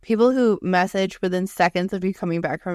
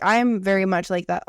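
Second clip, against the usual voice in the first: ordinary talk, nothing marked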